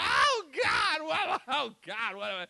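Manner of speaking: high, loud panicked wail; hammed-up cry of desperation; desperate cry. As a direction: Moses crying out to God in panic. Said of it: "turned to the Lord and said".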